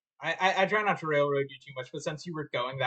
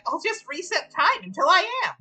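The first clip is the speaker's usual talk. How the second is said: higher smug boast; smug villain voice; 14 semitones higher, 6 dB louder than usual. Acted. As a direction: gloat like a sly flower. Flowey gloating.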